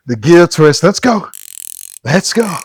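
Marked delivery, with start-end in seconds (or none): none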